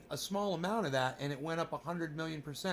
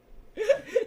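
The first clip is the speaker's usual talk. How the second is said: laugh; 5 dB louder than usual. Real, not acted. Cracking up at own bit.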